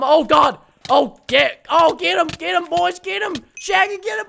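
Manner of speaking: loud, high excited shouting; real heat-of-battle shout; frantic urging. Real, not acted. Urging teammates on during a fight.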